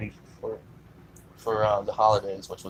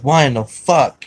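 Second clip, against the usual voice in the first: loud, higher-pitched angry demand; pissed-off dad; 4 semitones higher, 10 dB louder than usual. Retold or imitated. Son retelling his dad's reaction to the sick dog, pissed off. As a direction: imitate a furious dad demanding an answer. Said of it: "Gizzy was pissed as fuck".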